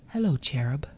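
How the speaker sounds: soft, low imitation of the conductor; smiling greeting. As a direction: greet sweetly through a smile, quietly. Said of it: "He smiles at me and he says".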